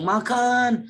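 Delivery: MAKAN high and loud, held long; drawn-out duration. Shown as a demonstration. Lecturer shows students a long makan forcing someone to eat, drawn out high. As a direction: stretch out MAKAN in a high, insistent call. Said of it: "durasinya panjang".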